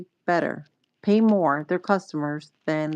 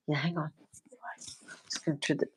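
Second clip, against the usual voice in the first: soft whisper in the dark; 2 semitones lower, 12 dB quieter, faster than usual. Real, not acted. Whispering by sleeping child.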